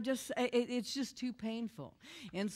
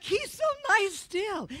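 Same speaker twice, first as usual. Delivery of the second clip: very high, loud mock wail; hammed-up high-pitched exasperation; exasperated disbelief; 11 semitones higher, 8 dB louder, slower than usual. Exasperated by the pastor's kindness.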